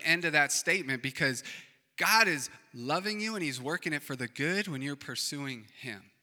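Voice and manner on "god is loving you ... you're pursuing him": ordinary delivery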